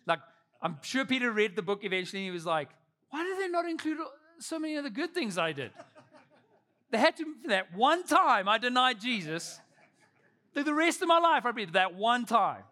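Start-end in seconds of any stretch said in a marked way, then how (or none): 3.1-12.6 high-pitched, comically aggrieved protest; indignant complaint. Acted. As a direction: complain in a pitched-up voice, building louder. Peter imagined complaining about his record.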